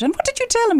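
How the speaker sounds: imitating a stunned son; incredulous child. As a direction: ask in mock astonishment. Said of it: "He said".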